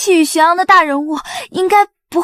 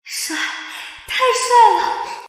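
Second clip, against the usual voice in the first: high, long drawn-out swoon; slow, high-pitched fangirl gush; gushing admiration; 7 semitones higher, much slower than usual. Acted. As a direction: stretch it out, starstruck.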